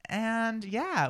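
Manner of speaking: normal talking voice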